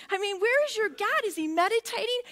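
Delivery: ordinary talk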